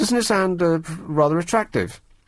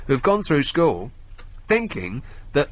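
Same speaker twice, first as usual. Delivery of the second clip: normal talking voice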